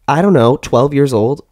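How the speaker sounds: ordinary talk, nothing marked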